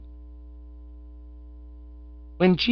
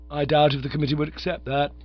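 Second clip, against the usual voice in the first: plain reading voice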